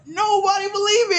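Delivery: high, wailing mock sob; mock crying whine. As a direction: whine it like a kid about to cry. Mock whining about not being believed.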